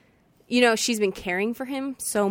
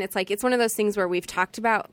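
usual speaking voice

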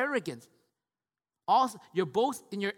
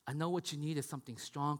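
gentle God; 5 semitones lower, 8 dB quieter than usual. Acted. Voicing God tenderly.

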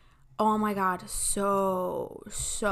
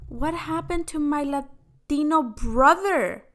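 high, drawn-out theatrical outrage; dramatic, offended disbelief; 6 semitones higher, 3 dB louder, slower than usual. Retold or imitated. Sister shocked at her brother's request, dramatically offended. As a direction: wail it slowly in mock shock. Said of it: "I'm so dramatic in this episode".

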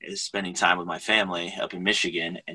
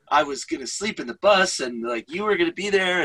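high pleading whine; whiny protest; 10 semitones higher than usual. Retold or imitated. Husband protests to his wife that the plans changed, high whine. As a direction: beg like a let-down kid. Whining self quoted to wife.

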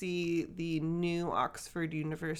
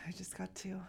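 unvoiced whisper; 12 dB quieter, faster than usual. Real, not acted. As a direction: whisper it softly. Whispered aside.

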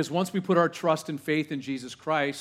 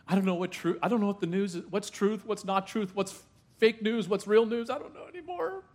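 higher and higher, a hammed-up flustered worrier; mock panic; 7 semitones higher than usual. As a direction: play the frantic believer who can't tell real news from fake.

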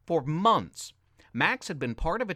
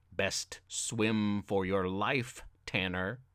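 deep, slow villain threat, softer; 7 semitones lower, 4 dB quieter, slower than usual. Acted. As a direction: menace him slowly, low and dark. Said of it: "A deep, villainous voice screamed".

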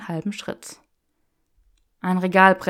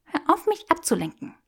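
put-on higher, playful young woman; teasing Hermione voice; 10 semitones higher than usual. Granger teasing Draco in the bookshop, playful and higher. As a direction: tease him with mock refusal.

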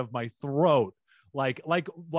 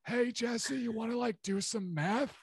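Badger; 9 semitones higher than usual. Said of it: "started turning into Badger".

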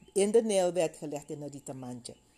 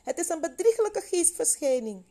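high, loud cry of alarm; panicked disciples, voice jumping up high; acted panic; 12 semitones higher, 6 dB louder than usual. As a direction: cry out as if seeing a ghost. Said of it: "raakten ze in paniek en zeiden".

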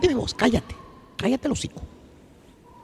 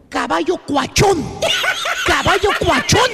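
shouted trigger word; 3 semitones higher than usual. Acted. Maestro shouting keywords at the laughing turqui. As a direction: shout the magic phrase as he cracks up.

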